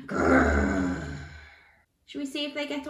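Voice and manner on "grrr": low, loud play growl; bear growl. Shown as a demonstration. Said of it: "Can you do me a bear grrr".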